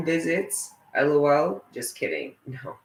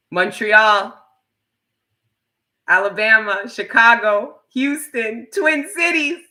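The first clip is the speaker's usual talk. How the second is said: higher and louder with every city, peaking in a squeal; rising hype; 12 semitones higher, 8 dB louder than usual. Real, not acted. Streamer calling out cities from chat, building excited cheer.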